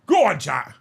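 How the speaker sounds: loud urging shout; sudden hearty holler; rallying call. Real, not acted. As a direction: cheer the crowd on. Urging the viewers on.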